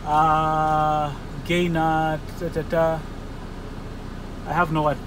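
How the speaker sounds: ordinary delivery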